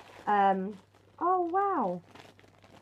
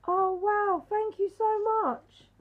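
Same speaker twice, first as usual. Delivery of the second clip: much higher, louder, slower heartfelt thanks; gushing thanks; 10 semitones higher, 6 dB louder than usual. Real, not acted. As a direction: overflow with joy at the gift. Thanking for a handmade gift.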